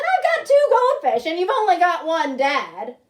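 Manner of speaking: loud, high indignant objection; indignant protest. Acted. Boy protesting an unfair swap, loud and indignant.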